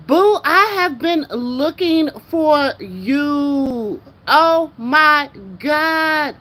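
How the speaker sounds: high, loud, slow cry of joy; overjoyed cry. Real, not acted. Streamer shouts joyfully to her sister who popped up on YouTube. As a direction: call out to her like you've searched for years.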